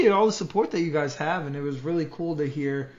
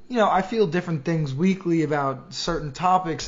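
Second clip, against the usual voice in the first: usual speaking voice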